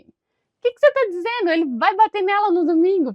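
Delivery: loud, high-pitched disbelief; hammed-up shocked questioning; mock shock. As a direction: feign outrage at a misunderstanding. Mock shock at literal meaning.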